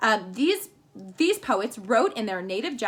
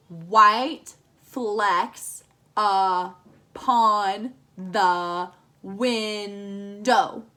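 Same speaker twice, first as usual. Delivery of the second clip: very slow, each syllable counted apart; 3 dB louder, much slower than usual. Shown as a demonstration. Teacher counting syllables for the class, very slow and separated. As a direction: tap out every syllable slowly. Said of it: "It should have seven syllables".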